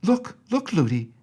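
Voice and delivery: very high, eager cry; excited child. A narrator's reading. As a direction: point something out in delight. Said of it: "She was always saying".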